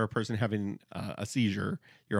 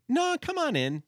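much higher, cheery come on in; mock doctor voice; bright doctor voice; 5 dB louder than usual. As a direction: sing it out like a chipper receptionist.

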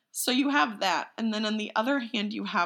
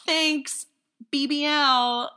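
breezy mock goodbye; 7 semitones higher, 6 dB louder, much slower than usual. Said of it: "totally cool with being like".

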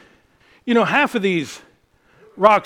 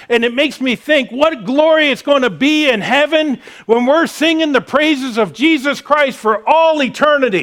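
higher, louder, rousing preaching; 6 semitones higher, 5 dB louder than usual. Acted. Preacher urging the congregation to praise God, rousing. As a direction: lift the church toward praise.

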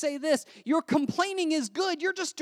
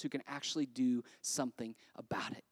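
whisper; 10 dB quieter than usual. Real, not acted.

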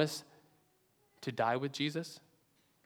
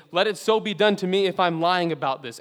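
louder, higher, vehement vow; swearing an oath; 5 semitones higher, 8 dB louder than usual. Acted. Peter swearing a curse on himself, loud and vehement. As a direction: swear it with everything you have.

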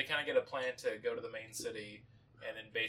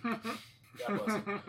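slow, drawn out through laughter; laughing; 10 semitones higher, 3 dB louder, much slower than usual. Real, not acted. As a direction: stretch it out while laughing. Repeats quip laughing.

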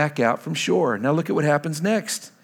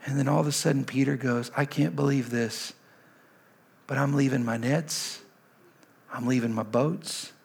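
much softer, lower, tender sermon voice; hushed intimate preaching; 5 semitones lower, 7 dB quieter than usual. Acted. Pastor voicing Jesus's call to the congregation, hushed and tender. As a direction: pull the room in close and quiet.

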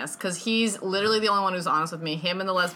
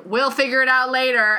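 loud mock dismissal; 6 semitones higher, 6 dB louder than usual. Voices careless producer of film.

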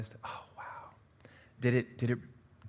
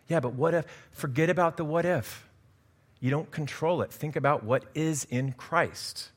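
a higher, urging preacher's voice; earnest preaching; 6 semitones higher, 4 dB louder than usual. Acted. Pastor urging the congregation to think on Christ, urgent. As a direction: press the point home to them.

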